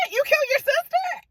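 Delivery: high, stunned gasp of a question; retold shocked cry; shocked disbelief. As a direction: act appalled, voice shooting way up. Family shocked at the killing.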